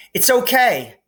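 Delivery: called up from below; calling up. A narrator's reading. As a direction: shout up to a distant opening. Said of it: "he called up to the light".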